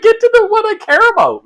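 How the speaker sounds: very high, loud comic screech; frantic high-pitched impatience; exasperated yell. Retold or imitated. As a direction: yell at the plot to hurry up. Ranting at dawdling plot.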